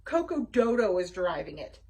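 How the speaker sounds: ordinary delivery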